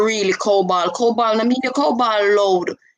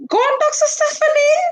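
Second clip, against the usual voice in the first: excited high call of encouragement; cheering shout. Real, not acted. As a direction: hype her up with a squealing shout. Cheering on the psychologist.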